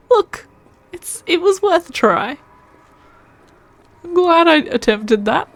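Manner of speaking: high, loud laughing words. Real, not acted. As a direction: laugh through the excuse. Laughing off a failed attempt.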